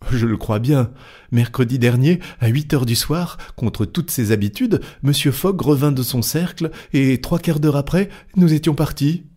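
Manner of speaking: lightly voiced valet getting carried away; excited manservant. A narrator's reading. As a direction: build up breathless excitement. Passepartout recounts hasty departure.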